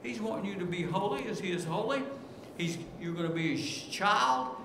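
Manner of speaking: normal talking voice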